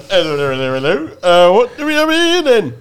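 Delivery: copper voice. Retold or imitated. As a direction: play the classic British policeman, pompous. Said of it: "he went".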